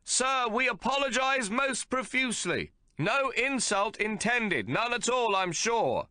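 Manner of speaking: embarrassed son apologizing; awkward shouted apology. A narrator's reading. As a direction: shout an apology over the noise. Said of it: "he shouts".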